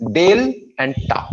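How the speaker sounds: "डेल" and "टा" as two distinct beats; two syllables. Shown as a demonstration. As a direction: set "डेल" apart from "टा". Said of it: "we have two syllables". ("डेल" और "टा" अलग करके)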